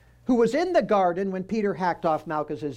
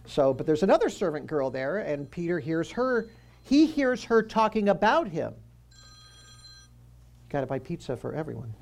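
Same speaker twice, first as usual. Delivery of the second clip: normal talking voice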